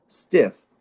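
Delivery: ordinary talk, nothing marked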